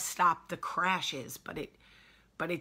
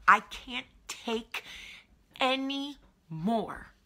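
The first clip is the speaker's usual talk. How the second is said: breaking down; 7 semitones higher, 5 dB louder, much slower than usual. Retold or imitated. Overwhelmed, voicing her breaking point.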